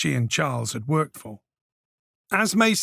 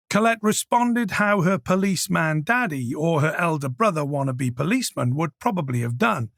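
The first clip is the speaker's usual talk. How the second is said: plain reading voice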